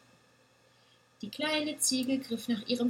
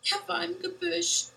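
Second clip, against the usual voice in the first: sobbing; 9 semitones higher, 3 dB louder than usual. A narrator's reading. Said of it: "schluchzte sie".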